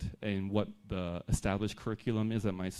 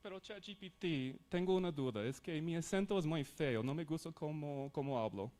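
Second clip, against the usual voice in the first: higher and softer, like a self-conscious learner; mock shy student; 5 semitones higher, 8 dB quieter than usual. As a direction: pretend to doubt your own accent, half-playful. Pretends to be insecure learner with AI.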